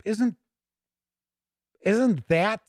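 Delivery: normal talking voice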